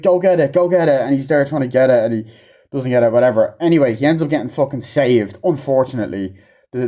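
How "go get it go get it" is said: higher, louder mock urging; pressing, raised-pitch command; urgent retold order. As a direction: imitate someone frantically sending a helper off.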